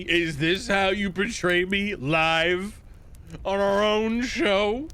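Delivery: high slow indignant voice; mock offended. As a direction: act hurt and outraged.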